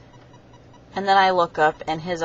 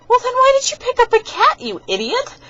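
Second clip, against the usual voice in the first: much higher, louder mock outrage; exasperated yell; 11 dB louder than usual. Scolding her friend for picking up a cat, high mock yell. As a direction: shriek it at him in disbelief. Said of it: "I'm like".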